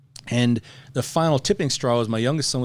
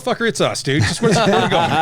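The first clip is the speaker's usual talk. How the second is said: much higher and louder, laughing in disbelief; exasperated outburst; 8 semitones higher, 7 dB louder, faster than usual. Retold or imitated. Teammate laughs loud and high at the cop's code talk. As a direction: blurt it out, cracking up at the absurdity. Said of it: "I'm like".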